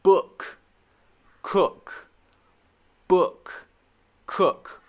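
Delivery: "Book", "cook" with a short clipped oo; teacher modelling the short oo; short oo words. Shown as a demonstration. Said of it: "this is not the long U sound".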